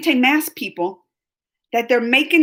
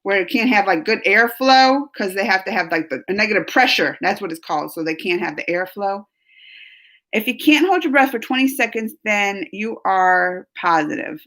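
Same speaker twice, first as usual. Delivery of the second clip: ordinary talk, nothing marked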